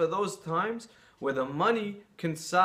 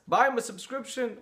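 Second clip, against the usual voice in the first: much higher, disbelieving repeat of the advice; incredulous echo; 10 semitones higher, 4 dB louder than usual. Retold or imitated. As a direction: throw the words back in disbelief. Man shocked at rabbi's advice.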